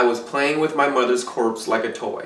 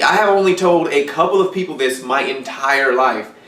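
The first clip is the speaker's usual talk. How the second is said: ordinary talk, nothing marked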